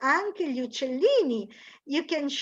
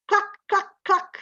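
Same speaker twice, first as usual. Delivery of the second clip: clipped clucks, a bit higher; hen clucking; 6 semitones higher than usual. Shown as a demonstration. Teacher imitating an English hen, light clucks.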